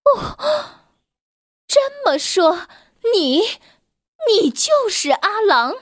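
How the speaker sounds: mother sheep stunned; shocked. Acted. Mother sheep realizing wolf is Alang, shocked high. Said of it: "大吃一惊".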